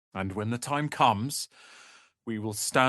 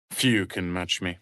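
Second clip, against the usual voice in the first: normal talking voice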